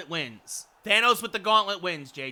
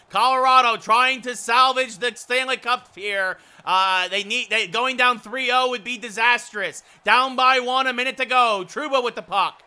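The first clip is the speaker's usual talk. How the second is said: loud, rising live hockey call; hockey play-by-play; 6 semitones higher, 10 dB louder than usual. Acted. Commentator calling the final minute for stream viewers, mounting excited play-by-play. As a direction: build urgency as the clock runs down.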